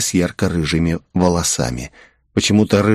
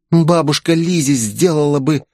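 put-on higher-pitched girl's protest; raised-pitch defiant objection; 6 semitones higher than usual. A narrator's reading. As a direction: insist on it, indignant. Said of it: "запротестовала девушка".

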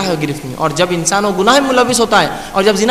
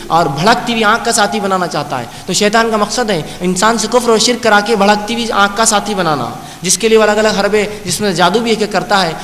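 normal talking voice

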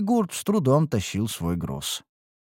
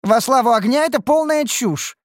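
high, indignant outburst; much higher, heated exclamation; flaring up; 12 semitones higher, 4 dB louder than usual. A narrator's reading. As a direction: flare up in hot-tempered protest. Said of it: "вспылила Неуру".

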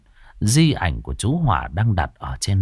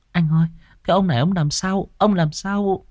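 high, hurried, scared questions; alarmed and higher-pitched; frightened wife; 9 semitones higher than usual. A narrator's reading. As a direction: plead nervously for your husband's answer. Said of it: "cô có chút hoảng sợ".

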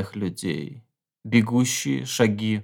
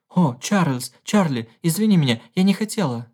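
high, pleading and fast; the wife begs her husband to forgive her; distressed apology; 7 semitones higher than usual. A narrator's reading. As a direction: beg his forgiveness in a rush.

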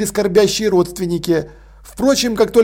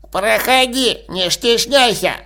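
put-on old man voice; 2 semitones higher, slower than usual. A narrator's reading. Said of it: "сказал труп".

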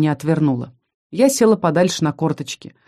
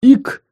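loud high hiccup; monster hiccup; 7 semitones higher, 6 dB louder than usual. Acted. Bloated monster hiccups at the heroine. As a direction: let out a sudden hiccup. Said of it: "выдохнула".

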